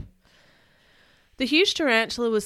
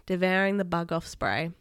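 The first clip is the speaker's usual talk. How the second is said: ordinary delivery, nothing marked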